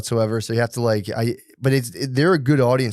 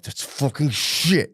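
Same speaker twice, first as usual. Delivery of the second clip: slow, drawn-out emphatic curse; mounting frustration; 3 semitones higher, much slower than usual. Retold or imitated. Parent accepting the mess on the floor, slowly exasperated. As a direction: stretch it out in dawning exasperation. Second take, frustration building.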